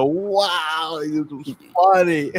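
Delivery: high, drawn-out wow; amused amazement. Retold or imitated. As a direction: marvel slowly with laughter about to break. Retells amazement, breaking into laughter.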